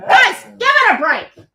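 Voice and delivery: high, loud telling-off; cranky mum. Real, not acted. To her noisy dogs, a loud high cranky-mum telling-off. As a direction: snap at them like a fed-up mum. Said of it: "I sound like a cranky mum".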